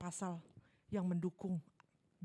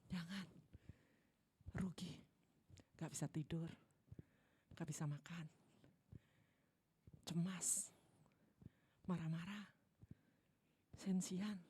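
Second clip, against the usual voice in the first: whispered; 3 semitones lower, 5 dB quieter than usual. Acted.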